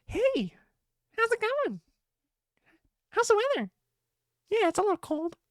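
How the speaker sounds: very high-pitched squeaky imitation; girlfriend's funny voice. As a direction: chat with yourself in a tiny high voice. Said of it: "doing, like, this voice".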